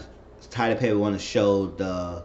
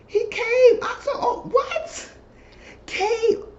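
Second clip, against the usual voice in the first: laughing shock; much slower than usual. Real, not acted. Telling the viewers he came, shocked laughing squeal.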